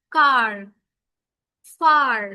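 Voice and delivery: কার shouted with a long a and strong r; stressed r sound. Shown as a demonstration. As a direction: belt out কার so the r rings. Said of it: "আরটা বেশি শোনা যায়।". (আর-ধ্বনি জোর দিয়ে, উচ্চস্বরে)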